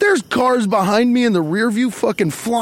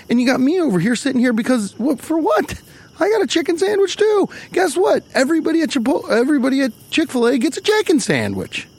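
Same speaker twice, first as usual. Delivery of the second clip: fast and rising higher into a heated rant; fired-up rant; 5 semitones higher, faster than usual. Real, not acted. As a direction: get worked up as you rant. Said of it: "Got too fired up".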